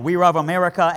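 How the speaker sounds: put-on Chinese accent, love said like ruv; light imitation of a Chinese accent on the word love; new citizens with Chinese accent; mock Chinese accent. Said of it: "they were like".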